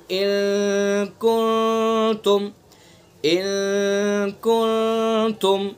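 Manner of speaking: इन कुंतुम twice, slow, the n hummed through the nose; nasal ikhfa. Shown as a demonstration. Teacher repeating the example slowly for the class, nasal. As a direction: draw out इन कुंतुम with a nasal ghunna. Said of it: "ये नाक की नरम हड्डी से अदा करना है".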